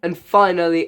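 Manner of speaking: ordinary delivery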